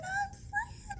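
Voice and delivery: high, loud panicked cry; hammed-up alarm of a little ghost; horrified squeal. Ghost discovers she turned red.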